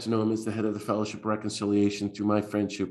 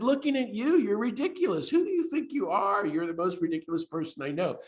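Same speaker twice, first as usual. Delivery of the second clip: very high-pitched teasing; squeaky-high mock ridicule; making fun; 10 semitones higher than usual. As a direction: imitate a friend ribbing you through hilarity. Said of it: "bursts into laughter and starts making fun of me".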